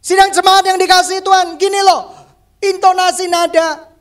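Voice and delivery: loud, high, demonstrative preaching; mock overbearing high-pitched address; high harsh intonation. Shown as a demonstration. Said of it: "nada-nada tinggi dan nada-nada sumbang terus".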